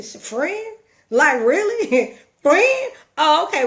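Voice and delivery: high, loud disbelieving questions; incredulous reaction. Retold or imitated. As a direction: balk at the word "friend", voice leaping up. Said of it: "I couldn't even say hello 'cause I started thinking".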